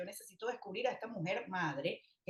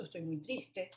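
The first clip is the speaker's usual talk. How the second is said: softer, quiet "Estoy muy triste"; sad admission; 3 semitones lower, 6 dB quieter, slower than usual. Shown as a demonstration. Mother tells her son quietly she is sad. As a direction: confess sadness softly. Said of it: "le puedes decir incluso".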